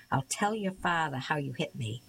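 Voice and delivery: usual speaking voice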